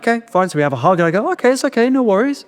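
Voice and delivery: fake breezy calm. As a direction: brush it off brightly, pretending it's fine.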